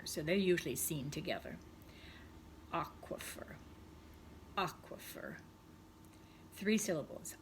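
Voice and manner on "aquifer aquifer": whispered aquifer. Shown as a demonstration. Teacher whispering aquifer to the class. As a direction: breathe the word aquifer out. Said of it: "let me show you how it's spelled".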